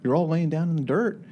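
high-pitched, coaxing instructor impersonation; instructor's lecture. Retold or imitated. Instructor urging men lying in the dirt to quit, mock coaxing. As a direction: egg them on to give up, voice lifted high. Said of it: "one of the instructors coming and lecturing us".

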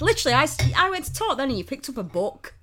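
loud, high-pitched indignant complaint; indignant telling-off. Real, not acted. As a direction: scold a friend for not listening. Scolding friend for reading while talking.

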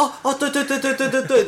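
very high, rapid panicky yeses; flustered agreeing. Retold or imitated. Clerk caving to the furious customer, high panicky yeses. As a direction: babble yes to calm an angry customer. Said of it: "很慌慌张张的".

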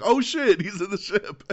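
high, loud startled outburst; high shocked exclamation; surprised realization. Retold or imitated. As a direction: catch it on the second viewing. Spotting character on rewatch.

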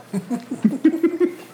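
high-pitched run of giggles. Real, not acted. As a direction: burst into a high, real giggle. Amused by romantic song remark.

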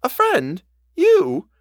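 high, astonished questions; surprised. A narrator's reading. Housekeeper hears the governess met a friend, high-pitched surprise. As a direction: leap up in pitch with disbelief. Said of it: "She showed her surprise".

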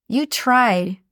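normal talking voice

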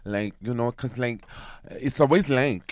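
sassy New York Puerto Rican woman, dragging the words; Suzanne. Acted. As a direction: put on a big Nuyorican street accent. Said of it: "I'm gonna give you her, like, Suzanne".

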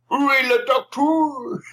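put-on French-speaking drunk crew man. Drunk craftsman with vodka wandering the set, a light French-speaking voice. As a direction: toss off a quick high French question. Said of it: "speaking French".

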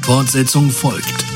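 usual speaking voice

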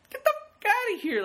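a pretend furious shriek at an intruder; outraged yell. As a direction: shout him out of the apartment. Imagined telling off a creep.

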